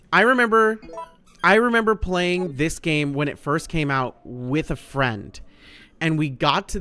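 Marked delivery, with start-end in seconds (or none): none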